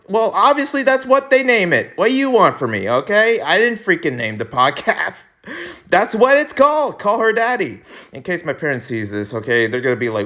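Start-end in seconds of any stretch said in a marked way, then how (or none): 0.1-7.8 mock defensive. Defending himself over the podcast name.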